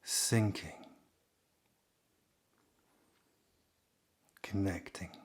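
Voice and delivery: calm, unhurried delivery with long pauses; guided meditation. Real, not acted.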